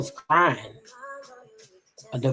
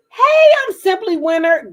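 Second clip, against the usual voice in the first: high, loud happy hail; excited greeting; 11 semitones higher, 9 dB louder than usual. Real, not acted. Vlogger greets a fellow YouTuber she is happy for, excited greeting. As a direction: call out brightly to a friend.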